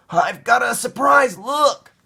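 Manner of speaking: put-on high, loud eager little boy; excited child. Caillou showing his toy dog, excited high voice. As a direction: show it off bursting with glee.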